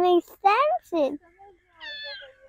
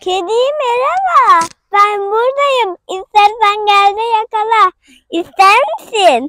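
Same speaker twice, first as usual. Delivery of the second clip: calling out; 11 dB louder than usual. Real, not acted. Child calls to the cat to come and catch, calling out loudly. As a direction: call out loudly to an animal.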